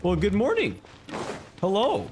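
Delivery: ordinary talk, nothing marked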